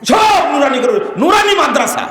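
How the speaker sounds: loud, high outburst of scorn; indignant shout. Real, not acted. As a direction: shout it in exasperation. Derides everything being named Nurani. (ক্ষোভে চিৎকার)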